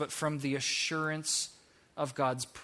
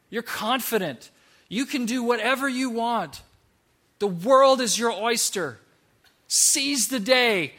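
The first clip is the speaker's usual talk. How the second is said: building higher and louder, slowing on the last line; hammed-up hype; 6 semitones higher, 7 dB louder than usual. Moses at the mirror hyping himself, building hammed-up hype. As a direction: pump yourself up to a big finish.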